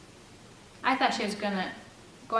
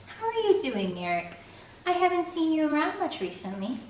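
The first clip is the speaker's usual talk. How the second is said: lightly voiced bright girl, higher; a hint of a prim churchgoer; Jamie's sweet voice; 9 semitones higher than usual. A narrator's reading. Narrator voicing Jamie chiding Eric, lightly sweet.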